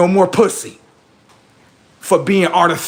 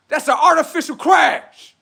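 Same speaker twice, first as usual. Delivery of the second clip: hyped punchline; 12 semitones higher than usual. Shown as a demonstration. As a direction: belt "That's an artificial crash" like a hype man. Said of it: "Say that again".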